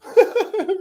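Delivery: quick high chuckle; high laugh. Real, not acted. Short high laugh. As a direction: chuckle while teasing viewers about what's coming.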